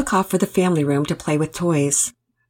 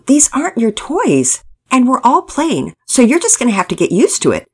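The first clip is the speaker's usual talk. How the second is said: emphatic, raised-pitch telling-off; blunt; 7 semitones higher, 5 dB louder than usual. A narrator's reading. Pearson sets Pablo straight about sharing toys, blunt. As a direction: lay down the facts, loud and higher. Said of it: "Pearson didn't mince his words".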